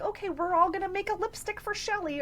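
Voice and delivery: high, chirpy mock host voice; imitating a cheerful host at an adult party. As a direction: announce it like a peppy party organizer.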